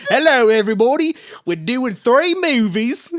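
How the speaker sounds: put-on loud, high British boy voice, slowing into a laughing shout; young actor announcing to everybody in British accent. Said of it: "it would have been more like".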